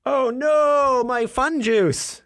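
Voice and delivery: high, wailing mock lament over spilt drink. Real, not acted. Host mourning his spilt drink, high mock wail. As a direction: cry out in hammed-up dismay.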